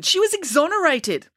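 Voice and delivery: high-pitched protest; indignant outburst; exclaiming. A narrator's reading. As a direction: insist in an outraged high voice. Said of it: "exclaimed Ian".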